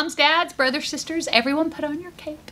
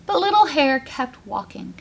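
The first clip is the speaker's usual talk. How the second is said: plain reading voice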